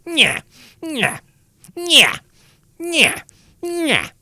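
high, loud nya nya taunt; childish jeering nyah chant; sing-song taunting. Shown as a demonstration. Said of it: "I'm gonna do it to you now".

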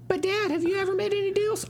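put-on a child's voice pitched very high, asking his dad; squeaky little kid with a question.

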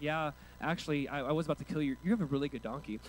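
low, soft, casual aside; understated angel. Acted. Angel owning up to Balaam about killing him, understated low voice. Acts angel's deadpan reply.

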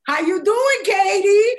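loud, high fake hello; mock greeting. Daughter greets the imaginary Katie, loudly sarcastic.

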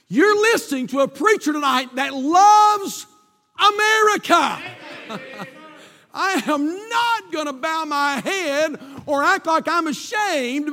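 high, loud preaching at full force; fervent preaching. Real, not acted. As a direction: drive it home to the congregation, stretching key words.

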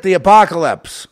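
"This is it" shouted high and loud; loud high rallying cry; booming proclamation. Retold or imitated. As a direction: declare it like the end of the world. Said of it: "R F K Junior says".

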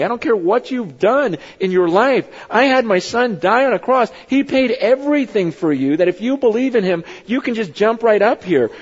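full-force pulpit preaching; impassioned preaching. Acted. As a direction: preach it hard and lift the room.

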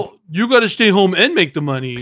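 mock the whiny fans' complaint; mocking fans.

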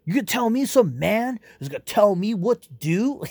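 mock indignant Wonder Woman. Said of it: "she gets all fucking woke on him".

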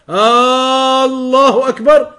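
Allahu Akbar said slow with the first A stretched long; elongated opening, wrong. Shown as a demonstration. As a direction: drag out the start of Allahu. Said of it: "To elongate it like that".

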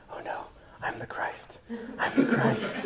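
breathless whispered realization; awed whisper. Retold or imitated. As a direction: whisper it in shocked disbelief.

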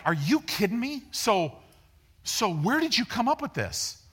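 high-pitched acted disbelief; incredulous teacher.

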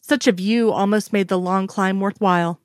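plain reading voice

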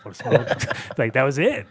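ordinary delivery

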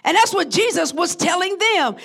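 ordinary delivery

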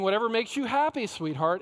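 hammed-up doting parent giving in; indulgent parent. Preacher mimicking parents who indulge their kids, sugary and doting.

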